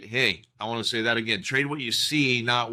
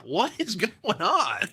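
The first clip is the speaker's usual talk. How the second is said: slow, higher, laughing through it; laughing disbelief; 8 semitones higher than usual. Real, not acted. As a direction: laugh at how absurd it is. Laughing at market rising.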